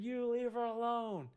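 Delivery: high, slow, drawn-out warning shout; re-enacted protective yell, pitched well up; retold full-volume yell. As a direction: holler it at the attacker, stretching each word. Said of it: "loud as I could".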